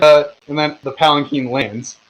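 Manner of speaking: ordinary talk, nothing marked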